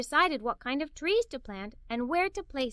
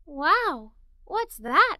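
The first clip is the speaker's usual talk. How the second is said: wow; 3 semitones higher, 6 dB louder, much slower than usual. A narrator's reading. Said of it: "I asked".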